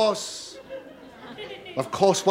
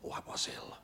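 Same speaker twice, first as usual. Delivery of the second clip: breathy whisper of misery; 16 dB quieter, faster than usual. Retold or imitated. Comic recalling his seasick crossing, hushed whisper. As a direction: whisper it like you're still queasy.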